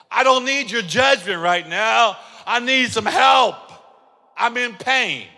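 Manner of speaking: a hurt patient pleading with the staff to stop judging; pained protest. Retold or imitated. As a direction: shout out the pain and the hurt. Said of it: "You'd be like".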